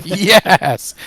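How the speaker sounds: loud, high, drawn-out yes; kid-like squeal. Retold or imitated. Fan recalling the Battletech game's release, kid-like squeal. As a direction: cheer like a thrilled kid. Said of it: "I squealed like a kid".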